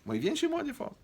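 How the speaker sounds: exaggerated high exclamation; high aside. Real, not acted. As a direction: jump way up in pitch.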